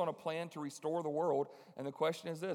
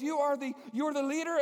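put-on high, loud, hopeful young woman; high eager girl; 9 semitones higher, 4 dB louder than usual. Amy asking the preacher if he is the leader, high and eager.

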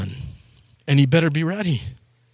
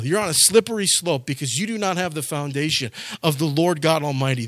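usual speaking voice

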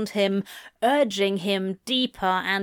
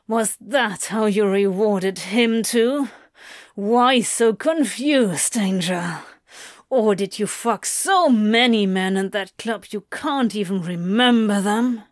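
hard, accusing lover's voice; jealous Crowley, taunting; 3 dB louder than usual. Acted. Crowley to lover about past night, jealous taunting. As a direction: taunt with bitter emphasis.

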